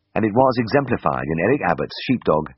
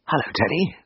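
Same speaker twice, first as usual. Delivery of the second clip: croaked; 10 semitones higher than usual. A narrator's reading. Drugged patient greeting his old classmate, dry croak. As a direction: rasp out a weak greeting. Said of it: "I croaked".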